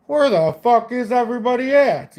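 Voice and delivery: high, loud mock Irish brogue; a bad Conor-style Irish yell; loud Irish accent. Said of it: "in his Irish".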